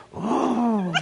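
hammed-up high wail; mock groaning. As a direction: imitate a woman groaning in a trance. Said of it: "And she goes".

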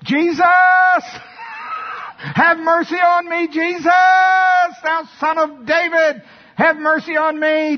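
full-force high-pitched shout; desperate bellow at the top of the voice; defiant crying out. Acted. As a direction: scream for mercy, refusing to be hushed. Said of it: "So th-, this was his reaction".

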